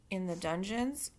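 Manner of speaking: ordinary delivery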